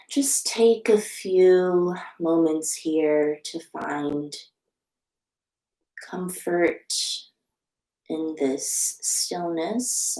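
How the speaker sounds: slow, soft meditation voice with long pauses; guided meditation. Real, not acted. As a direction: guide them gently into stillness, unhurried and hushed.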